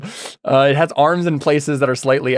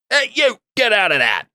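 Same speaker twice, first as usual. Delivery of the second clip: high put-on voice, falling sharply; higher character voice, shooing off a pest; Git's scolding voice; 8 semitones higher than usual. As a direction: call out a high-pitched telling-off. Git points at creature, scolds it.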